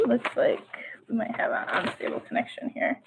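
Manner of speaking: very soft, half-whispered muttering; hushed note to self about a technical glitch; quiet aside. Real, not acted. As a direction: say it under your breath, almost whispering. Aside about a failing connection.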